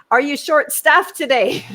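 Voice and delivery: high, loud, pointed question; loud pointed question again. Retold or imitated. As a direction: call it out for everyone to hear. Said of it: "Like this, said it again".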